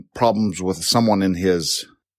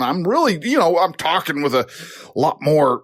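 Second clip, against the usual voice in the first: higher-pitched, worked-up enthusiasm; passionate gusto; 8 semitones higher than usual. Shown as a demonstration. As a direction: let the voice lift with excitement. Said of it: "gusto and ... passion in my voice".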